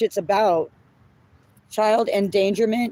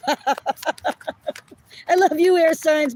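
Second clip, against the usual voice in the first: laughing; 8 semitones higher, much slower than usual. Real, not acted. Laughing fondly at air signs.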